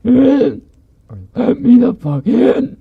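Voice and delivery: very high, loud, slow inhuman wail; weirdly toned creature voice. Retold or imitated. Creature repeating its demand outside the door, weird halting voice. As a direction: squeal it haltingly, like a cat taught to talk. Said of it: "And it just keeps saying".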